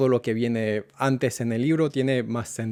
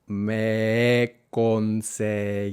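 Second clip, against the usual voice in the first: very slow reading; much slower than usual. Shown as a demonstration. Teacher reading along with the student, very slowly. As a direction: drag every syllable out slowly. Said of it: "la vamos a leer muy, pero muy despacio".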